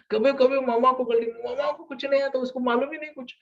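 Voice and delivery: बहुत ऊँची आवाज़ में माँ का मज़ाक उड़ाता किशोर; ऊँचे, चिढ़ाते सुर में टीनेजर की नकल; mocking teenager. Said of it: "ऐसा होता है उनका". (किशोर माँ को नीचा दिखाता है, ऊँची चिढ़ाती आवाज़ में)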